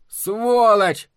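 loud, high yell of abuse; angry shout. A narrator's reading. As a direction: yell the insult after someone. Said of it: "крикнул он".

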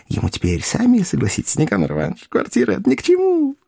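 high, put-on wheezy voice; hoarse whisper. A narrator's reading. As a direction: wheeze it like a confidential stage whisper. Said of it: "шёпотом сипел Коровьев". (сиплый доверительный полушёпот)

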